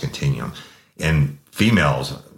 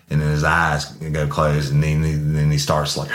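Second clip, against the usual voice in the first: usual speaking voice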